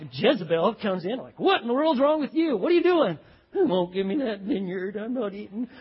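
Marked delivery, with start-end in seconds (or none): 1.4-3.2 loud, high exasperated demand; mock scolding. Jezebel coming in on her pouting husband, mock scolding. 3.5-5.7 mock sulky pout. Ahab complaining to his wife about the vineyard, mock pouting. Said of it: "he's pouting away, he's got his lip out there".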